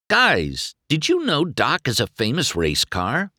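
high-pitched, eager announcement; excited news. Acted. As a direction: blurt out astonishing news to friends. Breaking news to the townsfolk.

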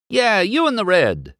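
high, insistent hail; calling out. A narrator's reading. McQueen hailing the red fire truck, calling out.

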